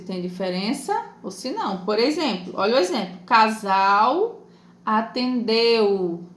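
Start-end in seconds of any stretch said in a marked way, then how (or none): 3.3-6.2 "Casal atendeu" very slowly, both ending in a U glide; teacher's slow demo of "Casal atendeu", final L sounded as U; same final sound. Shown as a demonstration. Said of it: "O som é o mesmo".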